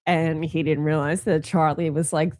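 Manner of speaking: ordinary delivery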